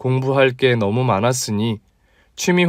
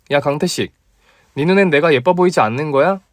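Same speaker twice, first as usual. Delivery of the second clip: put-on higher, teasing young woman; female friend voice; 3 semitones higher than usual. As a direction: play the bold female doctor, pitched up. (높은 톤의 당찬 여자 목소리)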